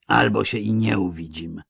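ordinary delivery, nothing marked

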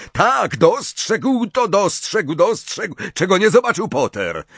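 high, excited match commentator; gleeful, high-pitched stadium commentary; megaphone commentary. A narrator's reading. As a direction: call the play with mounting urgency. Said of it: "zawołał przez megafon Zachariasz Smith".

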